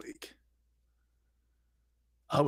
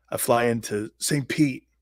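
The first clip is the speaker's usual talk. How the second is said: ordinary delivery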